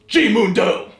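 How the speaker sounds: proud announcement. Acted. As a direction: announce it like a showman. Naming his own brand proudly.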